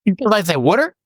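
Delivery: put-on high, playful Philadelphia accent on water; teasing his partner in a mock Philadelphia accent; Philly accent. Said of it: "you do not have a Philadelphia accent".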